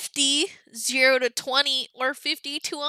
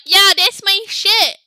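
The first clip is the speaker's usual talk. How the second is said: higher, louder hyped-up voice; hyped emoji face; 8 semitones higher, 9 dB louder than usual. Retold or imitated. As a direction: sell it like a thrilled fan. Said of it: "the second one is like".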